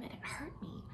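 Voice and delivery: high, whispered pain; hurt whisper. Acted. Leslie telling Anne of her pain, whispered. Painful confession.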